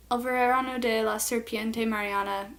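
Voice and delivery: Spanish read with American vowels; American learner pronouncing a Spanish title; American accent Spanish. Real, not acted. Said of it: "Sorry for my terrible Spanish".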